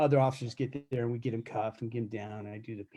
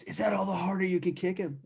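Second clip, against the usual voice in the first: raised, higher voice throwing a jeering challenge; mock inmate taunt; 7 semitones higher, 3 dB louder than usual. Barricaded inmate jeering at the officer through the door, mocking taunt. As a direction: call it out like a cocky prisoner goading the guard.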